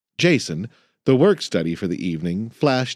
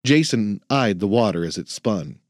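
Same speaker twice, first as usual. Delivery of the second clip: usual speaking voice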